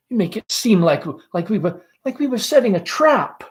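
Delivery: uneasy Laura. Acted. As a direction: stumble out the worry like a shy girl.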